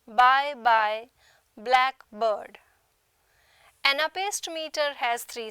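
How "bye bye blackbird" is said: "bye bye, blackbird" with every syllable stressed; spondaic stress. Shown as a demonstration. Teacher illustrating spondaic meter, both syllables stressed. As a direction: punch both halves of "bye bye" and "blackbird", louder. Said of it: "spondaic meter has both the syllables stressed".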